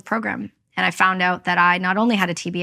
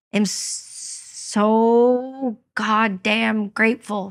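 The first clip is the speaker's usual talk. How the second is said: slowly, deeply sincere; real, slow, moved thanks; heartfelt gratitude; much slower than usual. Real, not acted. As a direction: let each word of "so goddamn grateful" land. Emotional thanks for supporters.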